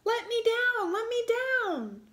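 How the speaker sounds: put-on upside-down boy begging to be let down; shouting. A narrator's reading. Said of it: "Hansel began to shout".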